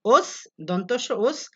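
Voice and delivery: ওস drawn out very slowly with an s; ওস with a clear s, slowly; teacher breaks down অশ্লীল as os-lil; slow syllable demo. Shown as a demonstration. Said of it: "অটা হয়ে গেছে ও".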